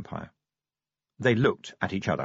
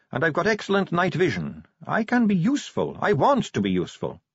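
high-pitched, insistent vampire recruit; Sally; 9 semitones higher, 3 dB louder than usual. A narrator's reading. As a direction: make an eager case in a high voice.

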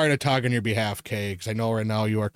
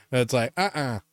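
usual speaking voice

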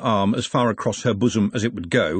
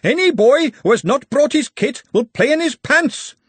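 much higher and louder, bellowed through a megaphone; megaphone shout; 14 semitones higher, 6 dB louder than usual. A narrator's reading. Referee bellowing at players through megaphone, shouting. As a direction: bellow it like a games master. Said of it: "raised his megaphone to his lips and shouted".

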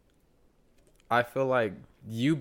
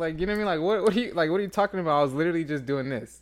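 raised, higher-pitched mock indignation; argumentative; 5 semitones higher than usual. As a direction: act out defensively starting an argument. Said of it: "that's when an argument starts".

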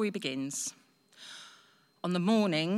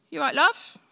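cheery phone greeting; 8 semitones higher, 8 dB louder than usual. Retold or imitated. Answering the phone to her friend.

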